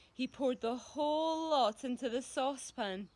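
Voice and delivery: ordinary delivery, nothing marked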